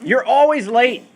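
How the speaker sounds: high, loud, drawn-out accusation; hammed-up nagging, lean on "always"; acted exasperated complaint. Teacher acting an everyday exaggeration, exasperated complaint. Acting out examples of figurative language.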